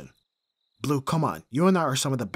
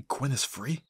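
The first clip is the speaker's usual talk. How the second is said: ordinary delivery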